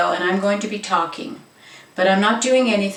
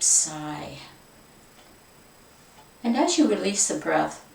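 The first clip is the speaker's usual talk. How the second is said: guided meditation, fading. Acted. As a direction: let the voice sink away with each exhale. Guiding breathing, fading.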